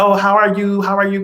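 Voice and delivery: sing-song over-sweet hello; mock-polite relative at the funeral; fake-friendly greeting. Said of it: "coming to be like".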